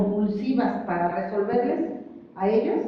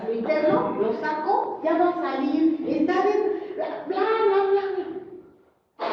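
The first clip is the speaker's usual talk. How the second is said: mock anxious newcomer; 7 semitones higher than usual.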